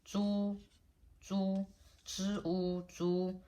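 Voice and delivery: "zhu" held high and even each time; level first tone. Shown as a demonstration. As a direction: say "zhu" on a high steady note, split once into "zhi, u". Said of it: "level tone".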